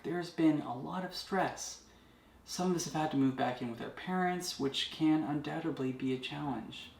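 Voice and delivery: calm wellness-host delivery; guided meditation. Acted. Leads a meditation video.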